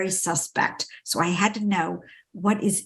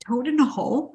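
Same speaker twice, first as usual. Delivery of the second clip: toad in a hole? asked higher, delighted disbelief; a delighted, incredulous echo of a funny dish name; amused surprise; 4 semitones higher, faster than usual. Real, not acted. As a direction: savor the silly name as a question. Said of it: "these are the best people".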